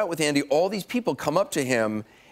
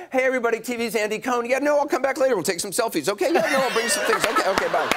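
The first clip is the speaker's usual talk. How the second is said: higher, fast, gushing celebrity patter; hammed-up Andy greeting; 7 semitones higher than usual. Anderson mocking Andy working the coach cabin, high and fast. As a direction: breeze through coach glad-handing everyone. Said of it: "he'll go across and be like".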